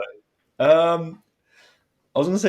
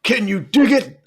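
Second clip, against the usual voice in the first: Booker T impression; 9 semitones higher, 4 dB louder than usual. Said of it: "You do him".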